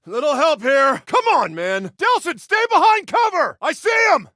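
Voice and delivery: high, loud battlefield yell; urgent shout. Acted. Officer yelling to his brother for help under fire, urgent shout. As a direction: holler over gunfire at full voice.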